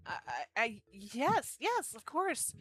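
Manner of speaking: high, loud, tumbling over his words; flustered excitement. Acted. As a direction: stammer happily, surprised and eager. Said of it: "Michael's jaw dropped".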